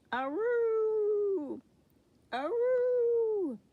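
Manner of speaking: high, loud drawn-out doggy howl. A narrator's reading. Spike to the pirates, loud high howl. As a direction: howl like a pup raising the alarm. Dog Spike howls at bow.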